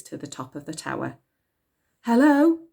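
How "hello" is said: high loud call into the room. A narrator's reading. Elsie calling into the empty clock room. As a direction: call out hello into the dark. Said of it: "called out Elsie".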